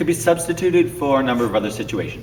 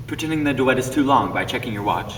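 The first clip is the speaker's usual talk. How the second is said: ordinary talk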